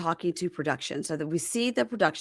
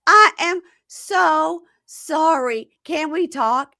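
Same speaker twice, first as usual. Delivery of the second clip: high, loud, drawn-out plea; mock pleading; 11 semitones higher, 8 dB louder, much slower than usual. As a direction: beg as the over-dramatic fiancée. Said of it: "and says".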